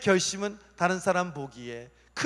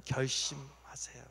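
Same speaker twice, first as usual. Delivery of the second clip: ordinary talk, nothing marked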